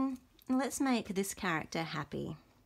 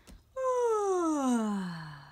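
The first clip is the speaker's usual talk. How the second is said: sleepy sigh; 7 semitones higher than usual. Pretend. Sleepy character after yawn.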